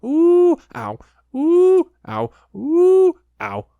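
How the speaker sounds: repeated high, loud squeals; high squeaky ooh ow. A narrator's reading. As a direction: bounce and squeal all the way. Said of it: "he was saying".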